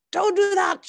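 high, loud warning shout. A narrator's reading. Father shouting at the boatman not to cut, high and loud. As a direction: stop him with a yell. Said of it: "shouted Thomas Clayson".

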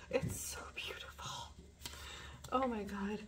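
breathless whisper of wonder; hushed whisper; awed whisper. Real, not acted. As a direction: whisper it, overcome.

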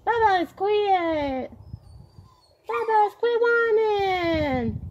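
very high, slow sing-song pet voice. Real, not acted. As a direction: coo at the whining dog, stretched out. Scolding neighbor's whining dog.

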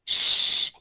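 Shh hissed voiceless through rounded lips. Shown as a demonstration. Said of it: "nhớ cong hai cái môi lên".

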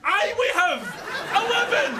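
high, exasperated protest; indignant. Acted. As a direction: yell back at the machine. Arguing back at the lift.

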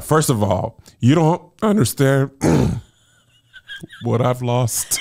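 retold angry crier holding it in; stern words with the voice giving way; voice cracking. Angry crier in a tense business conversation, voice cracking. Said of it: "My voice cracked".

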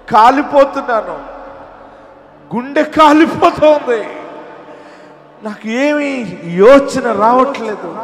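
rising into high, loud wails of despair; anguished cry. Acted. Preacher voicing a believer in fiery trials, anguished loud cry.

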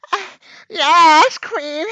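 chat voice. Acted. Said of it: "So I can do chat voice".

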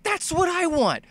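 very high, excited squeal; amazed player. Acted. Player seeing the title-screen blaster, high-pitched amazement. Voices player awed by rescuer's arrival.

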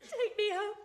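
crying as she begs to go home; tearful plea. Acted. Wife giving in, begging to go home, tearful. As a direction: let the words break with tears. Lady Windermere breaks down.

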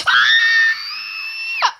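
sudden short yelp; a gasp-yell at the fall; startled low yelp. Real, not acted. Jump fails in parkour.

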